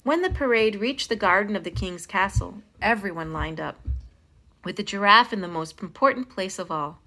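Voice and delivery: ordinary delivery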